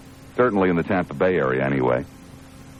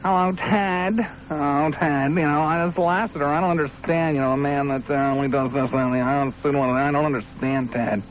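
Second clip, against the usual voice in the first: high, put-on voice of a timid listener; hammed-up high-voiced complainer on the phone; mock cowardly caller; 6 semitones higher than usual. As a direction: mimic a whining little caller, pitched way up. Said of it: "so many of you cowardly little folks".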